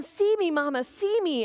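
high child's calling-out voice, mildly mock; child shouting. Little daughter calling to her mother at the park, high mock shout. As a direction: call up from the top of the slide. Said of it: "she yelled again".

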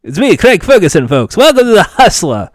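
lightly Scottish host voice; a hint of a Scottish host welcoming folks; Craig Ferguson. Host opens the show, a touch of Scottish. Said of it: "I tried to do a Craig Ferguson voice".

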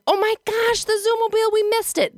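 high-pitched mock yell of dismay; retold yell. Mom blurting out in the car, mock dismayed yell. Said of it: "This is what I yell in the car".

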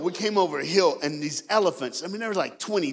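usual speaking voice

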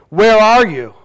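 shouted, high and angry; loud demanding call. Shown as a demonstration. Preacher shouting God's question to hiding Adam, angry. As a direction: bark it out like a furious God. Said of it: "Or do you hear God say".

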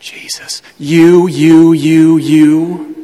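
loud hammering "you, you, you"; pounding repeated you. Acted. Preacher hammering the congregation with a loud repeated you. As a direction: drive each you home like a drumbeat.

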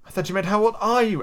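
high-pitched protesting exclamation; exclaiming. A narrator's reading. Alice corrects Humpty's question, exclaiming. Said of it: "Alice exclaimed".

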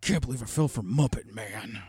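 lightly voiced gruff pig diva; a hint of Piggy's gruff growl; gruff Miss Piggy voice. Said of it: "her gruff voice".

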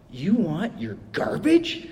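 slow, drawn-out disbelief; stunned worker asking slowly; incredulous question. Retold or imitated. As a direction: ask it like he can't believe it. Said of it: "the way he said it just kinda cracked me up".

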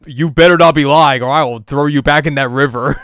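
mock threat. Inuyasha threatens the apprentice, loud mock threat. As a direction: threaten him with a hammy growl. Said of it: "and you're just like".

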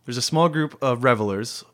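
usual speaking voice

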